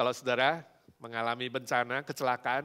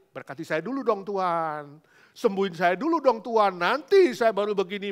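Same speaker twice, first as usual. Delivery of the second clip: mock pleading prayer; 6 semitones higher, 12 dB louder than usual. Acted demanding prayer to God.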